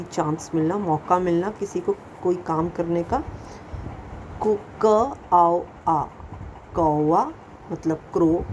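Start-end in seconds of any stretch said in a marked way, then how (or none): 4.4-7.2 क आओ said very slowly, then कौवा; sounding out कौवा. Shown as a demonstration. Teacher spelling a word for pupils: slow, clear sound by sound. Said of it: "sounding out कौवा".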